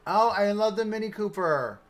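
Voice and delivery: high, excited gush; delighted. Real, not acted. Streamer spots a favorite car, delighted. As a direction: gush over it, drawing out the end.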